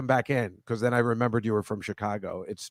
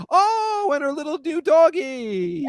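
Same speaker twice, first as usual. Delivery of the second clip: cooing at a dog; slower than usual. Real, not acted. Host coos over guest's new puppy, high baby-talk. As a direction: melt over the tiny dog.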